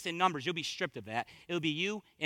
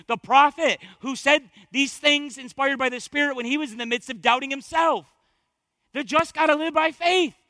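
high, loud, rousing exhortation; full-force preaching; 6 semitones higher, 8 dB louder than usual. Real, not acted. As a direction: shout encouragement to the congregation from the pulpit.

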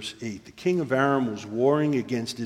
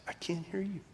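high, softened plea to God; acted soldier praying in distress; desperate prayer; 5 semitones higher, 7 dB quieter than usual. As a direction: plead upward in a small strained voice. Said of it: "he's praying to God".

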